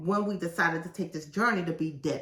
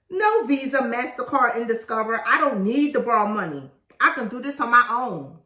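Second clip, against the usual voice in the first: higher, louder proud refusal; proud declaration; 4 semitones higher, 7 dB louder than usual. Pretend. Author proudly refusing credit cards, higher and louder.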